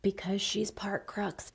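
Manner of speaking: slow unvoiced whisper. Real, not acted. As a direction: hush it like a secret. Gossiping aside about character.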